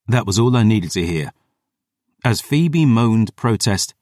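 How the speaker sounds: plain reading voice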